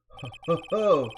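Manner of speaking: loud, hammed-up "ho ho" laugh; villain's chuckle. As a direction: gloat with a staged laugh.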